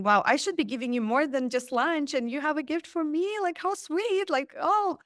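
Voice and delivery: mock-gushing thought, pitch climbing; touched excitement. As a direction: gush at the sweetness of it. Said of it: "in my head, I'm thinking".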